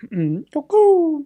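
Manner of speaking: high, slow singsong cuckoo; covert attention-getting call. Retold or imitated. Banker trying to catch a cop's eye, covert call. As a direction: coo it like a bird signal. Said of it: "trying to get his attention without".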